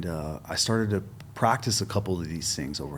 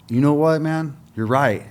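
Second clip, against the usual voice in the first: louder, higher convinced reply; emphatic agreement; 8 semitones higher, 7 dB louder than usual. Retold or imitated. Corey, suddenly convinced by him, loud emphatic agreement. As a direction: won over all at once.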